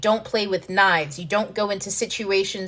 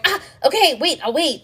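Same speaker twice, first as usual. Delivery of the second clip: flustered backing off; 8 semitones higher, 4 dB louder than usual. Retold or imitated. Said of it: "you're gonna go".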